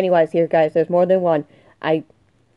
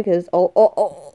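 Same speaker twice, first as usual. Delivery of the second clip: usual speaking voice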